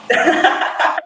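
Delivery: high, airy giggle; laughing. Real, not acted. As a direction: laugh off your own repetition. Amused at repeating herself.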